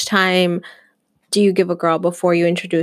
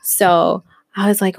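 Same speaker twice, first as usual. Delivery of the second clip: usual speaking voice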